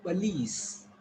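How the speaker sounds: police, said slowly as puh-LEES; correct pronunciation. Shown as a demonstration. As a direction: say "police" slowly, weak first vowel, long LEES. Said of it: "It's police".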